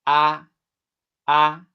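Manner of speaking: "Ah" held open and round, loud; "ah" as if showing the doctor your throat; full open ah. Shown as a demonstration. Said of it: "round, open sound".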